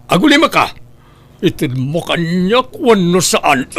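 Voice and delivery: shouted demand. Acted. Son threatens his father over the box, shouting. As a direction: bark the ultimatum.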